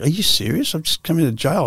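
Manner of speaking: telling the parole board in disbelief, high incredulous voice. Retold or imitated. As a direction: replay the shock at the parole officer. Retold outrage at parole board.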